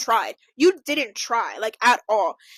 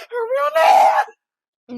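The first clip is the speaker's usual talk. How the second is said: high cry of laughter, slow; crying out through laughter; laughing; 8 semitones higher, 7 dB louder, much slower than usual. Real, not acted. Blurted name slip, laughing.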